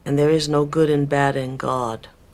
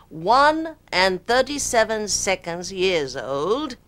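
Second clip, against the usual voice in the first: grand fake British spirit voice; bad British accent; 5 semitones higher than usual. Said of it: "Equipped with a bad British accent".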